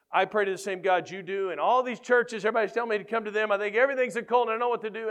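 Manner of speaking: high, loud, fast retold rant; angry. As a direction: vent in mounting exasperation, imitating an angry friend. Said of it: "he was just angry".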